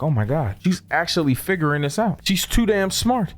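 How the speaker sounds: ordinary delivery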